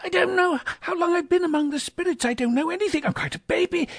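very high, dizzy with happiness; elated, childlike befuddlement; giddy Scrooge. A narrator's reading. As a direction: babble joyfully at the top of your range. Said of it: "said Scrooge".

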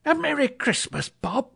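high, heartfelt greeting; mean every word of it; earnest. Acted. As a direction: Scrooge warmly greeting Bob. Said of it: "with an earnestness".

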